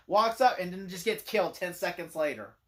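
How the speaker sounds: ordinary talk, nothing marked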